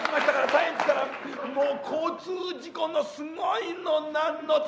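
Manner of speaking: breathless hyper comedian routine with laughs; Sanpei impression. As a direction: rattle on like the frantic comic, shouting bits. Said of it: "三平の舞台を一つここでちょっとやってみましょう".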